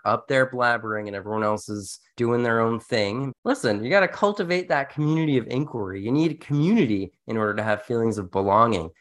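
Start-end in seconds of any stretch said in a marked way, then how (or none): none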